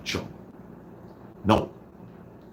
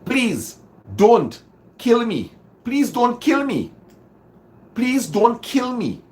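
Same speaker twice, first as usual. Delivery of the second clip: desperate begging; 11 semitones higher, 4 dB louder than usual. Shown as a demonstration. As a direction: beg over and over, pitched high. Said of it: "You hear any words?".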